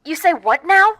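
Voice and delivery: high, loud, stunned; as if she misheard something awful; shocked at the best-man news; shocked disbelief. Acted.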